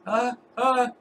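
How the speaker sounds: high-pitched panicked stammer; acted dwarf scared into stuttering; terrified stuttering. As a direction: stammer helplessly in a put-on high voice. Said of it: "he starts stuttering really bad".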